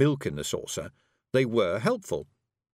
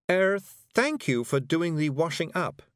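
higher, slow, lightly voiced courtesy; polite Miss Level; 7 semitones higher, slower than usual. A narrator's reading. Miss Level thanking Feegles for washing up, politely. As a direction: thank them slowly, pitched a little up.

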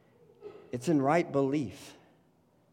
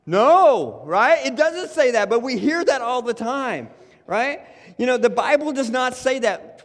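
louder, higher, hammering the point; preaching at full force; 6 semitones higher, 4 dB louder than usual. Acted. Pastor urging the church he cannot save himself, preaching loud. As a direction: preach it hard to the room.

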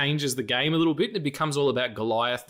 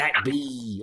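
Irish pirate voice. Retold or imitated. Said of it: "a pirate named Seamus".

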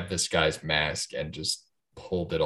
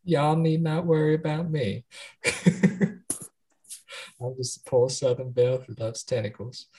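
higher, sweet mock Southern belle reassuring the men; 5 semitones higher than usual. Reassuring sailors about her undersea husband, Southern Belle voice. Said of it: "that was dead on".

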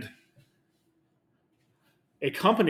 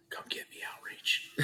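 whispering; 7 dB quieter than usual. Acted. Daring the company in a whisper.

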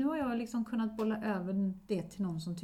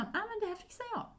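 husband quoted; 11 semitones higher, 5 dB quieter, faster than usual. Retold or imitated. Wife quotes husband taking over.

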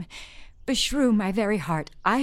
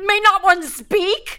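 loud, higher, slow; indignant protest; 8 semitones higher, 7 dB louder than usual. Acted. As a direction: demand your right to speak. Nurse protests being silenced.

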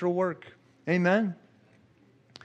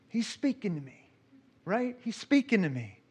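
hushed awe; 3 semitones higher, 8 dB quieter than usual. Real, not acted. Awed aside about the vision.

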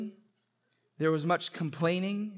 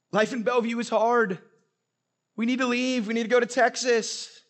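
mock complaining; 5 semitones higher, 4 dB louder than usual. Mocking local complaints.

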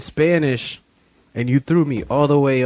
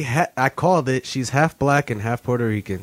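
usual speaking voice

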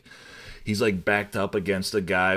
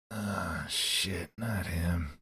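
low-key, softer muttered dismay; muttered realisation; 3 semitones lower, 8 dB quieter, much slower than usual. Retold or imitated. Host as the hero spotting a stolen badge, muttered dismay. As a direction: drop the volume as if to oneself.

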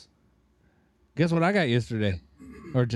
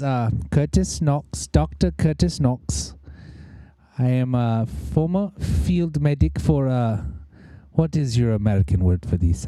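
put-on German accent. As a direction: play a flamboyant German medic. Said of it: "flamboyant German guy".

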